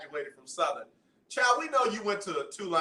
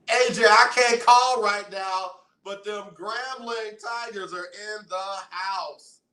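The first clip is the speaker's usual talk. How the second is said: loud, higher, slow excited read; excited shout-out; 4 semitones higher, 6 dB louder, slower than usual. Real, not acted. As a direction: announce it loud and hyped. Reading listener message aloud.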